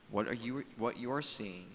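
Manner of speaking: ordinary talk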